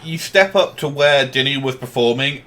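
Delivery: usual speaking voice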